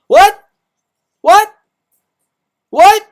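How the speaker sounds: high, loud yelled what; screaming what at the top of the voice; shouted what. Shown as a demonstration. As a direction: belt it out in mock disbelief. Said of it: "I'm gonna say what, what, five more times".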